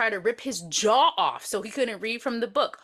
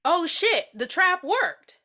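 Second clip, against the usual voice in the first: mock-startled, raised pitch, drawn-out ending; mock surprise; 7 semitones higher, 3 dB louder, slower than usual. Mock reaction to trap working.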